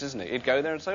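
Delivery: usual speaking voice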